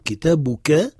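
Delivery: kitabuka said carefully, every syllable voiced; classical Arabic pronunciation. Shown as a demonstration. Teacher modelling the classical form, careful syllables. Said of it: "En arabe classique, on dit".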